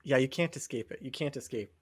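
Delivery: ordinary talk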